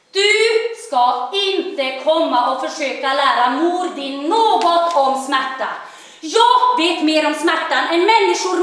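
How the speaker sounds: rising angry lecture from a hurt mother. Acted. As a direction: scold like an offended mother. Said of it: "Då blir min mor arg".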